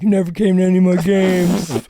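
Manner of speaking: hammed-up wounded outburst; mock tantrum. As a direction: whine it loudly like a little brother throwing a fit. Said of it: "maybe you throw a fit".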